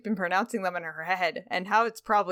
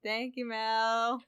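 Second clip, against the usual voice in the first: tender thanks; 3 semitones higher, 4 dB quieter, much slower than usual. Real, not acted. Thanking listener after reading.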